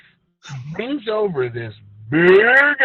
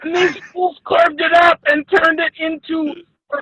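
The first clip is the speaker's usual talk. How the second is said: higher, louder, hammed-up teary anger; mock furious rant; 9 semitones higher, 5 dB louder than usual. Storyteller snaps at his cohost about his eaten pet goat, hammed-up teary anger. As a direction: rant like the wound is still fresh, half-crying.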